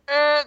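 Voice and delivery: high, loud wordless whine; mock the squeal of an overwhelmed fan; hammed-up fanboy noise; starstruck fanboy squeal. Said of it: "like, a huge fanboy".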